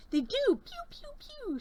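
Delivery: high pew pew blaster noises; mock laser zaps. Playful host making laser sounds for the beads. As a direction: zap it like a toy ray gun. Beads shooting daggers at negativity.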